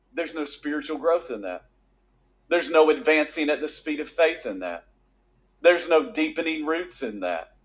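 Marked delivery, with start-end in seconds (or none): none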